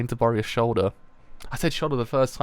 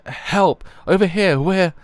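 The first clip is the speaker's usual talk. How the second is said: loud, raised shout for help; acted cry for help, pitched up and loud; calling for help; 7 semitones higher, 8 dB louder, slower than usual. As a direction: call out urgently to someone over there. Character calls out for help.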